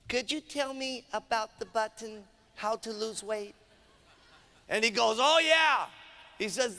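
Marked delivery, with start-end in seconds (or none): 0.1-3.5 soft, timid woman's voice; hushed put-on voice for a hesitant shopper; Mary. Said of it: "she says to, to Bob". 5.2-5.9 higher, eager burst; mock enthusiasm, pitch jumping up; excited. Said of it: "you're very excited about the products".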